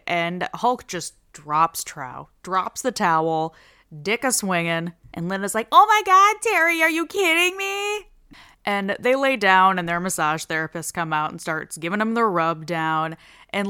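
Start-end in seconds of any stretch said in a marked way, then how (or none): 5.7-8.1 very high, loud exclamation; mock scandalized shriek. Wife to husband who dropped his towel, mock scandalized shriek. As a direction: shriek it in exaggerated disbelief.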